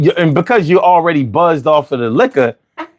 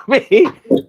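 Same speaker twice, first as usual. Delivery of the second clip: ordinary talk, nothing marked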